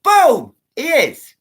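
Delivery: loud high burst of triumph, punch the boom; excited boom. Real, not acted. Shouting a triumphant boom to her live viewers. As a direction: shout it out like a win.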